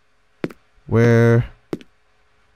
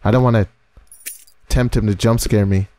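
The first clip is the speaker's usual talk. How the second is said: usual speaking voice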